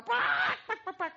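high panicked hen squawks; frantic squawking. Acted. Hen fighting off the baptism, high frantic squawking. Hen refuses water again.

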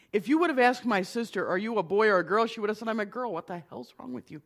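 ordinary talk

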